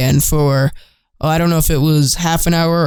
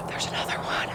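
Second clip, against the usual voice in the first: awed whisper with gasps; 19 dB quieter than usual. Real, not acted. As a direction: hush so the deer stay. Quietly watching deer herd up close.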